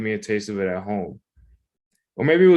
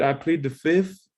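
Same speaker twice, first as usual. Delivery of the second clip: slow, higher evasive voice; sketch quote; 6 semitones higher than usual. Retold or imitated. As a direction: drawl it out like a sitcom witness. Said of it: "Remember that Chappelle Show skit".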